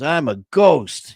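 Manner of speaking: put-on high, slow little-kid voice; little boy. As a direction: announce it proudly like a small boy. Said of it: "And he goes".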